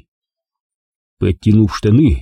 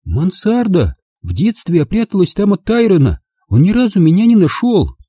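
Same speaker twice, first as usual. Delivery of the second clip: Angela's voice; 9 semitones higher than usual. A narrator's reading. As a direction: spell it out to him in disbelief. Angela excited. (Анжела изумлённо настаивает, высоким голосом)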